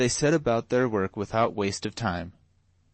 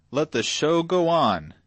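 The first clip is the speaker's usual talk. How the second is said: loud, slow, higher bellow; drunken shout; 6 semitones higher, 6 dB louder than usual. A narrator's reading. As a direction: roar it out for the whole room. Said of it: "shouted Canty".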